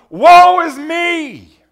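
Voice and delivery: high, drawn-out loud wail; crying out. Acted. Preacher voices Isaiah seeing the Lord, anguished loud cry. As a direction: wail it slowly at full voice. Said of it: "he cries out".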